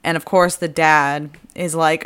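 ordinary delivery

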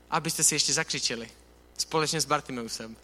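normal talking voice

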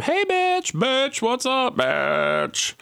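high, sing-song sassy greeting. Retold or imitated. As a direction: toss out a playful high bitch.

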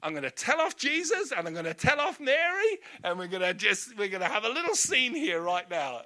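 indignant threat. Retold or imitated. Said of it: "It's like".

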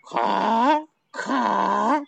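two long, high caws; crow's caw. Shown as a demonstration. Lookout crow warning its mate, long high caws. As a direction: caw out as the bird on watch. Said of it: "So the giant go".

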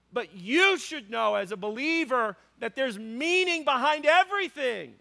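high, loud preaching voice with punched key words; impassioned preaching. Real, not acted. Pastor telling believers pain has purpose, impassioned. Preacher drives home the point at force.

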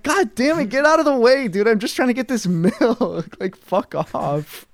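high, loud exasperated griping; annoyed rant. Retold or imitated. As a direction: vent at the pushy crowd. Retold annoyed rant at crowd.

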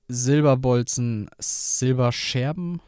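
ordinary talk, nothing marked

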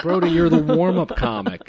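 incredulous laughing comeback; laughing at a self-important friend; laughing retort. Retold or imitated. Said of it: "I'm like".

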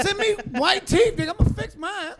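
high, heated mock protest; retold protest. Client to his dentist friend, an indignant high protest. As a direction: push back on the dentist, hammed-up. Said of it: "I said".

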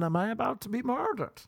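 high, rising mock terror; panicked thought. Man in bed fearing murder, high mock panic. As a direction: squeal the question in comic fear.

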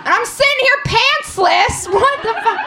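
high-pitched indignant disbelief; hammed-up exasperation; comic outrage. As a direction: protest it in a high, appalled voice. Continues outrage at the doctor.

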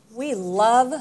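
usual speaking voice

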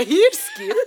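"Hursku" high, questioning, oddly Scottish; Scottish-sounding Afrikaans. Real, not acted. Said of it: "You sound Scottish".

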